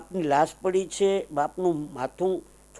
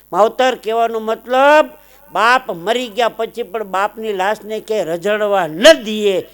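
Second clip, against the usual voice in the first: fervent preaching; 7 semitones higher, 10 dB louder than usual. Real, not acted. Preacher's climactic moral.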